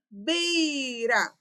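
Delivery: "Beira" with BEI held long and punched; stressed syllable drawn out. Shown as a demonstration. Teacher answers own question on stress, "Beira" with BEI drawn out. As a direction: stretch the first syllable of "Beira", BEEEI-ra. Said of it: "a sílaba tônica é o bei".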